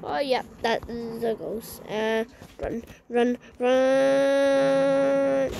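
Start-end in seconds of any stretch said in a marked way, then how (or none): 2.6-5.5 urgent shout. Real, not acted. Fleeing a ghost, yelling at her horse to run. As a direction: yell it, higher and louder.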